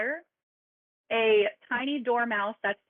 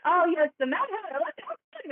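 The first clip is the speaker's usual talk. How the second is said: excited hare voice; 7 semitones higher, faster than usual. Acted.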